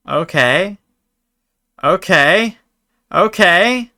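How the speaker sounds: hyped okays rising in pitch; building excitement. Real, not acted.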